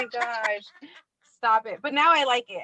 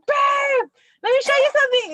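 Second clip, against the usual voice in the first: much higher, loud eager voice; excited husband; 6 dB louder than usual. Retold or imitated. Husband eager to show new smart lights, excited. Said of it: "And he's all excited".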